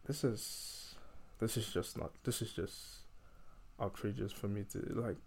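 low-energy, hushed, halting start-and-stop; quiet disbelief. Real, not acted. Speaker overwhelmed by how outrageous this is, quiet and halting. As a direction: falter softly, as if lost for words. Lost for words at the injustice.

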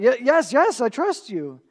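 high, loud and stammering; desperate cry. Retold or imitated. Dangling climber answers God, high loud panicked stammer. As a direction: blurt it out in panic, slowly. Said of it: "The man cries out".

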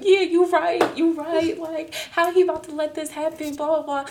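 high-pitched, sustained tipsy indignation; drunk agreeing. Retold or imitated. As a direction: imitate yourself drunkenly siding with a friend. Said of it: "I'm sitting up here drunk".

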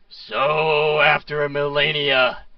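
booming arena announcer; magically amplified voice. Acted. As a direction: boom it out like an unseen host. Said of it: "suddenly you hear a loud voice boom out".

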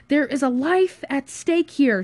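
high, loud, emphatic retort; screamed rebuke. Acted. Friend defends emptying the minibar, screaming. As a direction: yell it slowly and urgently. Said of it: "screamed Josh".

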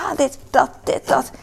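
high-pitched acted-out mental to-do list; busy racing thoughts. Busy mother imitating her own active head, high and hectic. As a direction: toss out each word high, like thoughts popping up. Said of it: "Ik denk van".

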